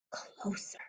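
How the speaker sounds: suspense whisper; hushed closer. Acted. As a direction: whisper it as if the thing is near. Something rustling nearer.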